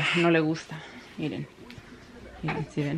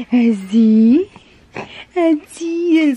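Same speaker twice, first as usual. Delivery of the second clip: high, louder sing-song; 7 semitones higher, 8 dB louder, much slower than usual. Real, not acted. Mother cooing to her baby while settling him.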